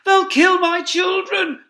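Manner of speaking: high, loud, slow terrified cry; crying out in fear. Acted. Mother fox fearing for her children, terrified cry. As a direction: wail it in terror for your cubs. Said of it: "cried Missus Fox".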